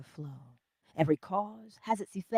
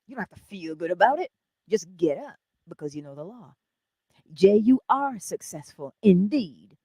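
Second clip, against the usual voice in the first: ordinary talk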